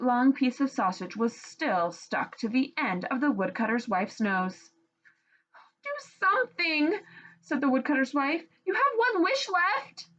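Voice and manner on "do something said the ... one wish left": pleading wife. Acted. Wife with sausage nose.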